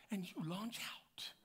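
slow whispered close. Acted. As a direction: let it fall to a slow whisper. Third point, dropped low.

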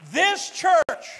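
high, loud, emphatic opening; preacher's proclamation. Real, not acted. Preacher begins a big claim to congregation, ringing proclamation. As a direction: announce it grandly, then leave it hanging.

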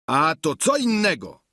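high-pitched squire's exclamation; exclaiming. A narrator's reading. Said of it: "zawołał Sancho". (okrzyk wysokim głosem)

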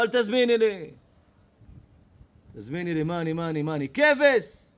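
hammed-up furious diner. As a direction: blow up at the waitress. Angry diner refusing reorder.

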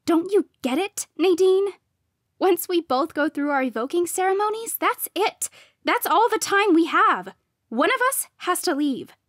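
high, loud, urgent rant; heated desperation. A narrator's reading. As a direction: drive the point home to a rival. Rival's desperate heated explanation.

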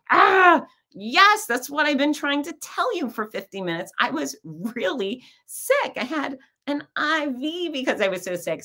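high-pitched, vindicated mock outburst; comic exasperation. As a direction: burst out at finally being believed. Exasperated at finally being believed.

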